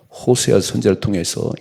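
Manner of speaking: usual speaking voice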